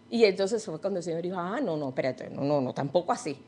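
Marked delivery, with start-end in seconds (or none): 1.4-3.4 the Lord reconsidering. Acted. The Lord retracts his offer.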